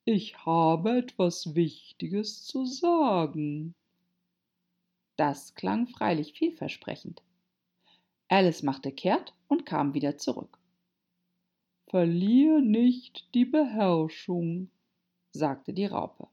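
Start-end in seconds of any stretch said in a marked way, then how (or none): 0.1-3.8 slow, drawn-out caterpillar voice; stretched, self-important call; calling after. A narrator's reading. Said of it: "rief die Raupe ihr hinterher". 11.9-14.7 slow, higher, drawn-out voice; admonishing caterpillar. A narrator's reading.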